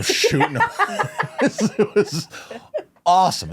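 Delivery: high, breaking into real laughter; laughing while talking. Real, not acted. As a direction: tell it through delighted laughter. Cracks up at favorite moment.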